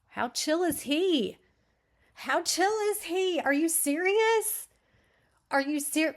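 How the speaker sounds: high, loud, drawn-out disbelief; incredulous outburst. Real, not acted. Reader stunned by how chill the dad is, loudly incredulous. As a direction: really stretch it out in loud disbelief.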